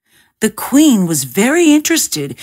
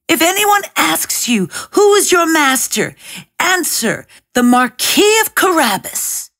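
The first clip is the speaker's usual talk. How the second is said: mock shout; 8 semitones higher than usual. A narrator's reading. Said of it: "shouting at the peasants".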